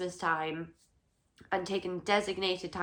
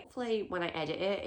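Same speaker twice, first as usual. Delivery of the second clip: ordinary talk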